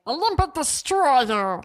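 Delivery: squeaky cartoon alien voice; mock-cute cartoon creature voice; Stitch voice. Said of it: "like, a Lilo and Stitch voice".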